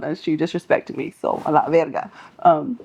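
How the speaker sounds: ordinary talk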